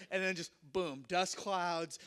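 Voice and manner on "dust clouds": ordinary delivery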